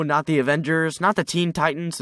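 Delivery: usual speaking voice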